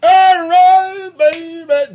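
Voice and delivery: high, loud hype transition; loud high host callout. Acted. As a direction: shout it like a host hyping the next bit.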